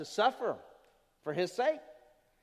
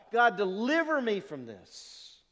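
loud, drawn-out plea to God; begging; 3 semitones higher, 5 dB louder, slower than usual. Acted. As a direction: plead desperately, voice raised and slow. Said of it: "it's a picture of somebody begging on their knees".

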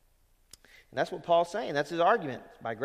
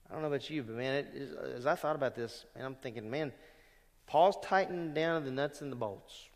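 ordinary delivery

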